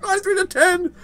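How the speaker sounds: high triumphant cry; excited shout. Real, not acted. Gamer calling out the vote count, excited and high. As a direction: crow over the result.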